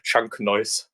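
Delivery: usual speaking voice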